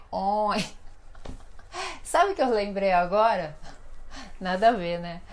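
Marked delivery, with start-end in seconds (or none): 0.5-0.5 a single nasal "on", held like the ões ending; nasal sound demo. Shown as a demonstration. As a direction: demonstrate "on" through the nose. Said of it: "O negócio é falar assim".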